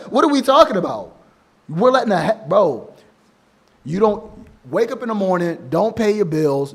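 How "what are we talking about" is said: high, louder, fast through a laugh; cracking up at how absurd it is; incredulous laughing outburst; laughing disbelief. Real, not acted.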